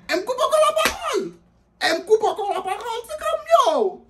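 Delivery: very high, loud incredulous yell; exasperated shout. Real, not acted. Comedian ranting to the crowd, very high and loud. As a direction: yell it in disbelief.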